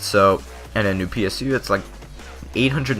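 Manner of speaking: ordinary talk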